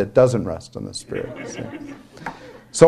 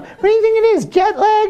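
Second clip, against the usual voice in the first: mock clueless tourist. Mocking their own chatter.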